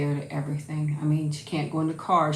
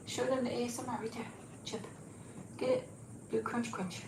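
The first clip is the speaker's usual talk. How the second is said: hushed and pitched up, coaxing; soft high coaxing; 6 semitones higher, 12 dB quieter than usual. Real, not acted. Coaxing the dog to crunch for viewers, soft and high. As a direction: softly urge her to crunch.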